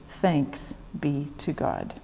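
prayer response. Real, not acted. Liturgical close of a prayer.

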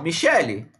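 "Michelle" with a final ee; Portuguese pronunciation. Shown as a demonstration. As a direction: say "Michelle" the Brazilian way, ee ending. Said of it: "no português, eu falo". ("Michelle" com i final, em português)

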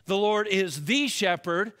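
"the" stressed as "thee", rising end. Shown as a demonstration. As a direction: hit "the" in "The Lord is the shepherd". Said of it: "Notice David did not say".

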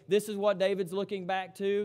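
ordinary delivery

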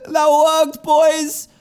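high and loud victory call; triumphant cheer. Real, not acted. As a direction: celebrate loudly with the viewers. Triumphant outro.

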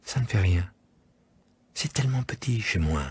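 put-on quiet, serious child; a touch of solemn child; grave little prince. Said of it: "remarqua gravement".

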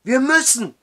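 high, loud desperate shout; urgent shout. Acted. Matthew shouts at the drowsy judge to flee, desperate. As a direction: yell it, no time left.

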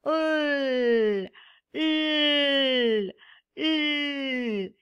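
L held long and slow with no vowel; teacher showing the sound of l; letter sound. Shown as a demonstration. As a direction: let L hum slowly. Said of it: "Y el sonido, eh, de la L es".

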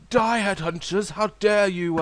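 high, loud indignant war cry; battle cry. Pretend. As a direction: charge in yelling at them. Attacking headhunters.

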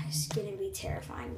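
hushed, unvoiced dread; nervous real whisper. Real, not acted. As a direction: breathe it out in a whisper. Whispering before ritual.

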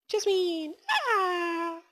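high, drawn-out call; high-pitched holler trailing into a cry. Real, not acted. Calling creature to come.

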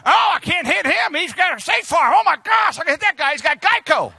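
high, loud mock truck voice; voicing the semi. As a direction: play the truck gleefully choosing its target.

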